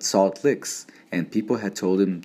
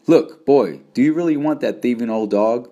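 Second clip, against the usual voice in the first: put-on louder, raised-pitch challenge to a child; pointed question; 4 semitones higher, 5 dB louder than usual. A narrator's reading. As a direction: get the boy's attention, then press him. Man confronts screaming little boy.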